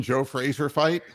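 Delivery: normal talking voice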